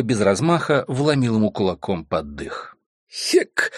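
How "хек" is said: breathless unvoiced grunt; winded grunt. A narrator's reading. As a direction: grunt as the wind leaves you. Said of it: "глубокомысленно вякнул тот".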